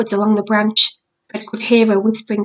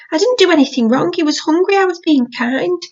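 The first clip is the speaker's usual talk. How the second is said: put-on higher, sulky child's complaint; pouting; 7 semitones higher than usual. Little boy defending himself to his sister, pouting. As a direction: sulk like a wronged little boy. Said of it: "He was pouting".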